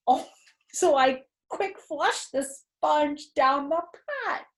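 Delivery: ordinary delivery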